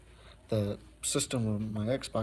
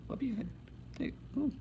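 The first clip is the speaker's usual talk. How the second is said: squeaky high "Whoop your head"; high pet voice; 13 semitones higher, 4 dB quieter than usual. Real, not acted. Coaxing a pet.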